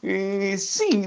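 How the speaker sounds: sehr hohe kleine Vogelstimme; Vögelchen. Acted. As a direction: antworte zögernd als Küken.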